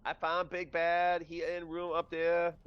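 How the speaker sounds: high-pitched voice in broken English; Thunder. Acted. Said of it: "Thunder would already be like".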